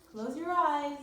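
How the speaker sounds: ordinary delivery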